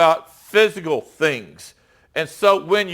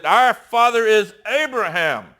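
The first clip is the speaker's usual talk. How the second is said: a touch of mock anger, pitched up; mildly indignant; 6 semitones higher than usual. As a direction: replay the line as if the crowd is getting mad. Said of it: "Now they're getting mad".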